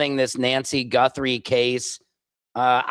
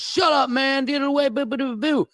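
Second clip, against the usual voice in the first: higher, hammed-up bellhop shooing someone off; mock-shout at the crazy guy to go away; imitating bellhop; 10 semitones higher than usual. Bellhop voiced in retold story.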